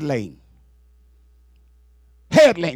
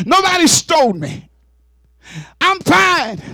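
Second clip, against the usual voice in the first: reassuring Jesus voice; 9 semitones higher than usual. Acted. Risen Jesus comforting Mary, high joyful reassurance building to a shout. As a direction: reassure her, rising to a shout of triumph. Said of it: "Jesus says unto her".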